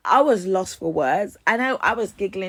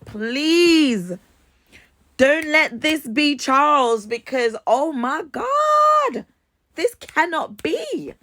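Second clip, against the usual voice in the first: hammed-up pleading, louder and higher toward the end; desperate plea; 3 semitones higher, 4 dB louder, slower than usual. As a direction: mock-desperate prayer building to high, loud wails. Acted desperate prayer to God.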